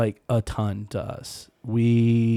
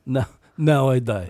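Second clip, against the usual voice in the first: put-on Russian accent; 4 dB louder than usual. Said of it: "he might have just said it like".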